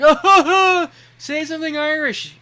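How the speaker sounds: a plastered guest begging with a laugh; high giggly drunk partygoer; drunk party girl. Retold or imitated.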